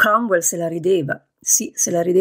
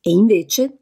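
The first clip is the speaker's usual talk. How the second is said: plain reading voice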